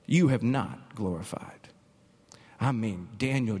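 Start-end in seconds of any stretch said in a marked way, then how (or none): 0.0-1.7 solemn slow preaching. Acted. Preacher voicing Daniel over music.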